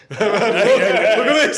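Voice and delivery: slow, loud words through laughter; laughing. Retold or imitated. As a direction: drag it out while cracking up. Mocking their design process.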